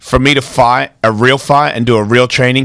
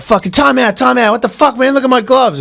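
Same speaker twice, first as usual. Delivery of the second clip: high, indignant protest about bloody gloves; the whiny fighter yelling time out; outraged complaint; 8 semitones higher than usual. Retold or imitated. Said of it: "he's like".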